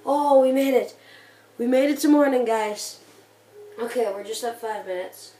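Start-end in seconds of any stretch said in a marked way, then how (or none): 0.1-3.0 loud, high relief; relieved cheer. Real, not acted. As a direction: celebrate surviving. Survived the night.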